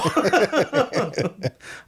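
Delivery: laughing high and delighted; amused laughter. Real, not acted. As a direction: giggle at your own impression. Laughs after quote.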